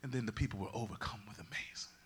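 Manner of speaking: breathy hushed narration; awed storyteller's whisper. Acted. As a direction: whisper it like a secret.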